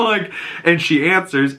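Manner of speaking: ordinary delivery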